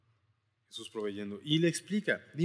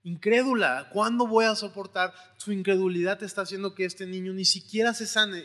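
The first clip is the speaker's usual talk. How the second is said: annoyed rebuke; 7 semitones higher, 6 dB louder than usual. Acted. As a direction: rebuke the faithless crowd.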